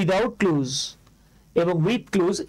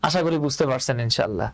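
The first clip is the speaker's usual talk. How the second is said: normal talking voice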